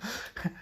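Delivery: short high giggle; high burst of laughter. Real, not acted. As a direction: break into a quick laugh. Teller laughs at own joke.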